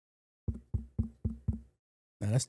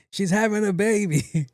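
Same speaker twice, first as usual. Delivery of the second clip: urgent announcement; 7 semitones higher, 3 dB louder than usual. Retold or imitated. Spinning into the hospital entrance.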